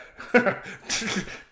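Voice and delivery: high, unvoiced wheezing laugh; a breathy snort of a laugh; horse laugh. Horse laughs mid-line.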